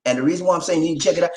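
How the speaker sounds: ordinary delivery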